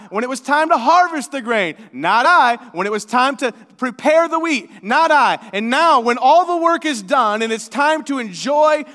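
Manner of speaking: hammed-up petty hen rant; indignant scolding. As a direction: list their refusals like charges, building indignation. Hen scolds animals who refused.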